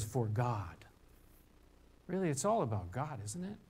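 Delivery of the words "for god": "For God" low and hushed; quiet emphasis. Shown as a demonstration. Pastor landing the verse's answer, hushed and low. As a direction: drop low and soft on "For God". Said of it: "He says".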